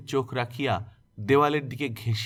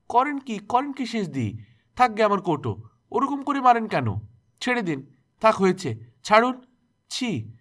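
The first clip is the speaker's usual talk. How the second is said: high, loud, urgent pleading; alarmed protest; 9 semitones higher, 4 dB louder than usual. A narrator's reading. Tuni's mother grabbing the aunt's hand in loud alarm. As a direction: stop her in shocked alarm. Mother pulling aunt away.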